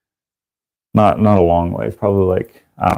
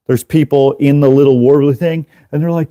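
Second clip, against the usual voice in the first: ordinary talk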